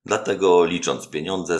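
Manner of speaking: ordinary delivery, nothing marked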